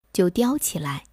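usual speaking voice

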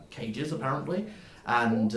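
ordinary delivery

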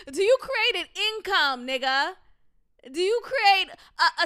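loud, high, disbelieving questions fired off; incredulous ranting. Real, not acted. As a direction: grill him in mock outrage, laughing at it. Mock interrogation of the guy.

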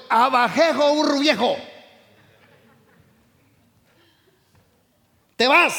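loud, defiant command to fear; telling fear to leave. Shown as a demonstration. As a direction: shout it at fear to drive it off. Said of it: "dígale hoy al temor".

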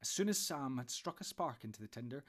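ordinary delivery, nothing marked

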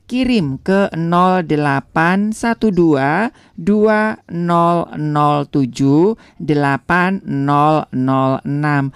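each digit slow and clear; slow dictated number. Shown as a demonstration. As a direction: spell the number out slowly. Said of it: "sekali lagi ya". (nomor dibacakan pelan dan jelas)

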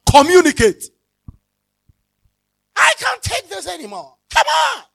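high, loud exasperated yell; angry shouting. Acted. Preacher acts out a frustrated spouse yelling at home. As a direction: vent at the whole house in frustration. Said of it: "But the shouting is a wrong call".

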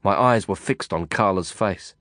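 ordinary delivery

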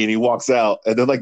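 normal talking voice

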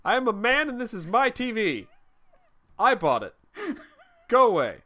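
high, loud hammed-up claim; mock manly boast. Dad shooing the kid away from his TV. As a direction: puff up like a territorial dad.

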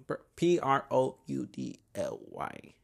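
slow, spell out P R O U D L Y letter by letter. Shown as a demonstration. To listeners, asking how to say it, spelling it slowly. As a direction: spell each letter of proudly slowly, one at a time. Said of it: "¿Cómo se dice proudly?".

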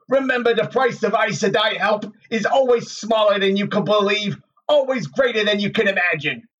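sardonic warning. A narrator's reading. Tom's apparition warning Rand to run, sardonic. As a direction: goad him with warnings, rising to an urgent cry. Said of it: "Tom grinned at him sardonically".

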